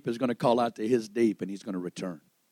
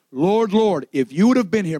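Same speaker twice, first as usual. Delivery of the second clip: acted plea, raised and loud; pleading cry; 5 semitones higher, 5 dB louder than usual. Mary says the same phrase as Martha, pleading cry. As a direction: call out to the Lord in grief.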